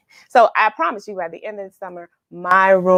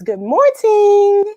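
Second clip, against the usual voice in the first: bright chirpy greeting; 10 semitones higher, 6 dB louder, slower than usual. Retold or imitated. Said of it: "She says".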